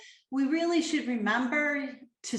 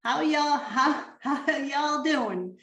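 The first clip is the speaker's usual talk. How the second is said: mock Southern; 3 semitones higher, slower than usual. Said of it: "That's my attempt at being Southern".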